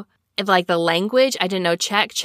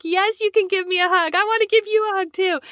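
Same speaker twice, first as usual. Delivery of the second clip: high, gushing delighted reply; excited; 12 semitones higher than usual. Retold or imitated. Traveller accepting a hug from a sister missionary, excited high gush. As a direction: gush back eagerly, pitched way up.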